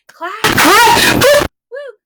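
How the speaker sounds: high, loud woo-hoo and hooray; big excited whoop; loud cheer. Acted. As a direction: cheer like a party. Cheer in the song.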